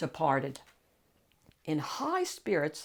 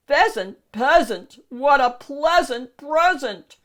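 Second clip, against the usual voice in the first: higher, louder delighted ogre; loud gleeful ogre gloat; gleeful wordplay; 6 semitones higher, 8 dB louder than usual. Acted. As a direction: relish the rhyme with greedy glee. Ogre gloats over food.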